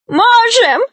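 tiny, very high gnome squeak; tearful squeak. A narrator's reading. Gnome Venya answers Grandfather Frost, tearful high squeak. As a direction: pipe up shrilly through tears. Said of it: "сквозь слёзы пискнул гном Веня".

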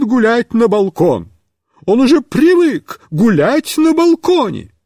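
high, indignant fussy voice; offended fussy lady; indignant neighbour. A narrator's reading. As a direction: demand an answer, offended.

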